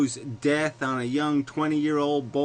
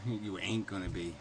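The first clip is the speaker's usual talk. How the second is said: soft Southern; 5 semitones lower, 9 dB quieter than usual. Real, not acted. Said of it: "all of a sudden Southern".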